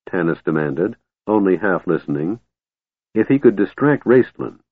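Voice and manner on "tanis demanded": ordinary delivery, nothing marked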